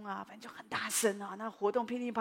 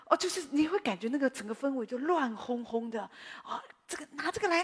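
mimicking busy organizers; 5 semitones higher than usual. Imitating chaotic bustle.

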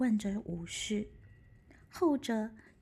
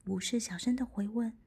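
ordinary delivery

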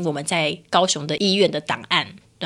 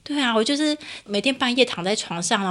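ordinary talk

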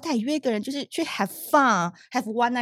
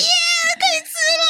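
high gleeful whoop; mock cheer; 3 dB louder than usual. Friend cheering that the porridge is finally theirs, high gleeful whoop. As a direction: cheer like a hungry drunk friend.

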